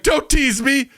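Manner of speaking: high, loud, needy demand; greedy toilet voice. Pretend. Toilet begging the user to hurry up, high needy voice. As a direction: beg like a starving toilet. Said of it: "It's like".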